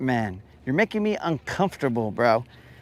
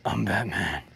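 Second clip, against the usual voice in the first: low, soft, breathy Batman; a caption-worthy breathy growl; 8 semitones lower, 8 dB quieter than usual. Retold or imitated. Imagining closed captions, low breathy Batman voice. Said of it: "spoken in breathy voice".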